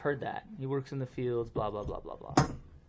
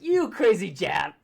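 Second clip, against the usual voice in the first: higher, louder, slow playful jab; playful, teasing; 6 semitones higher, 8 dB louder, slower than usual. Shown as a demonstration. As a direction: tease a friend fondly, slow and loud. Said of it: "say Jap in a funny way".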